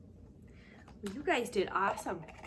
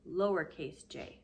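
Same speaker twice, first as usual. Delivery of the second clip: ordinary delivery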